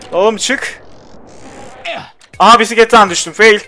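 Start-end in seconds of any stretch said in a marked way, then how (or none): none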